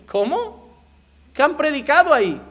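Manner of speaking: high, loud mock disbelief; can you believe what they preach; incredulous. Pastor scoffing at other churches' sermons, loud and incredulous.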